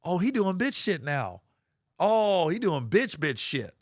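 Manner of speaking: incredulous outburst. Retold or imitated. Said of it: "And I was like".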